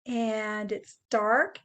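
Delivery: normal talking voice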